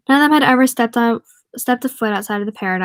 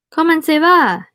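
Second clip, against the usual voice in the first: higher, louder French greeting; Saint John Valley French; 8 semitones higher, 6 dB louder than usual. Acted. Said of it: "in the Saint John Valley French".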